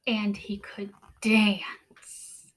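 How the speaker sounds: slow, hushed storybook reading; read-aloud narration. A narrator's reading. Teacher reading a picture book to the class, hushed. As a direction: draw young listeners into the story.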